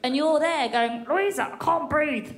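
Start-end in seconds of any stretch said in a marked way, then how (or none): 1.1-2.3 slow muffled gasp of a smothered man. Pretend. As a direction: squeeze it out like you're suffocating. Voices man under her.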